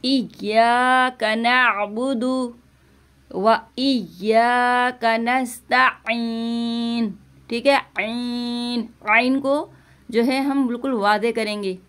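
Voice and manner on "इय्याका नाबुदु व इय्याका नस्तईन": slow, drawn-out tilawat; Quran recitation. Shown as a demonstration. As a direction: recite slowly and clearly. (धीमी तिलावत)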